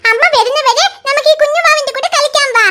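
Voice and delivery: ordinary talk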